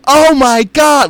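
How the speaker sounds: high, slow "Oh my God" shout; excited shout. Retold or imitated. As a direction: yell it out in disbelief. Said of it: "comedians ... going".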